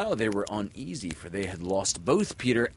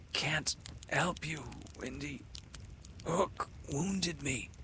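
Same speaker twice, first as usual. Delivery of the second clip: weak confession; 8 semitones higher, 7 dB quieter than usual. Acted. Wounded Peter confessing to Windy, soft and sad. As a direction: admit it with failing strength.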